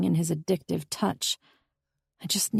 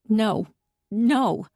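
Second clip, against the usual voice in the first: anguished denial; 5 semitones higher, 4 dB louder than usual. A narrator's reading. Crying heroine refuses.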